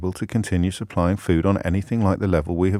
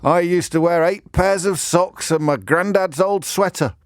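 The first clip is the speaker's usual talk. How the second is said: higher, slow, mock-fond nostalgia; nostalgic old-timer; 8 semitones higher, slower than usual. Mocking people who brag about hardship, higher and slow. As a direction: play the granddad-sweater nostalgic. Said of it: "and people go".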